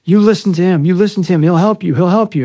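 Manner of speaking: high, loud, insistent pleading yell; imitated yelling. Kid runs in and urges the man to listen, imitated yelling. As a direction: act out a kid urgently yelling the same plea over. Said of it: "starts yelling at him".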